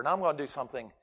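normal talking voice

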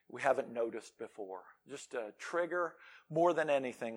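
soft, low, earnest prayer voice. Real, not acted. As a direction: pray quietly and reverently. Pray with me.

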